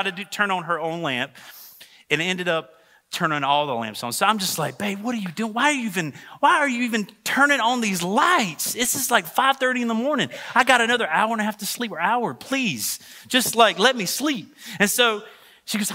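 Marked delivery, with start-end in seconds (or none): 4.8-14.5 high-pitched, put-upon complaint; exasperated pleading. Retold or imitated. Husband to wife at five thirty in the morning, exasperated pleading. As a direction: act out pleading with someone to let you sleep.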